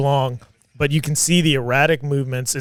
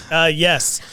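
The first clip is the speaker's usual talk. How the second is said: usual speaking voice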